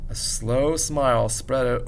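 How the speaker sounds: usual speaking voice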